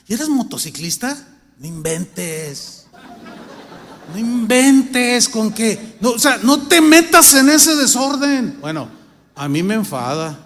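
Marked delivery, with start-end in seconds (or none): 1.6-2.9 softer, slow chuckled "no inventes"; soft laugh. Real, not acted. Preacher chuckling quietly to the congregation, slow. 4.1-6.0 loud, slow, laughing disbelief; laughing exclamation. Real, not acted. Preacher laughing in disbelief at biker believers, loud and slow. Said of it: "preacher laughs, repeats louder". 6.0-8.6 loud scolding. Real, not acted. Preacher scolds the congregation.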